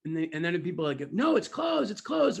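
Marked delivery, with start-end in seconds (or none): 1.1-2.4 high, loud insistent calls; imitated crowd yelling. Audience correcting the boss, yelling. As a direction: yell a correction like several people at once. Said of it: "They're all yelling".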